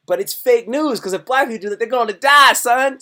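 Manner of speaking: rising to a loud cry on die; emphatic warning. Real, not acted. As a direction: hammer the warning home, son. Excited warning to the audience.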